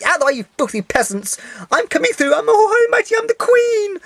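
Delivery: high, haughty mocking voice of a pampered celebrity; mock snooty star. Fan mocks the star who pushed past her, high haughty diva voice. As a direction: sneer it out like a diva sweeping past. Mocking the actress's grand entrance.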